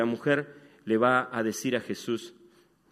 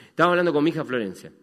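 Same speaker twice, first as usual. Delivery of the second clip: emphatic self-correction; 3 semitones higher, 9 dB louder, much faster than usual. Acted. Se corrige tras un lapsus.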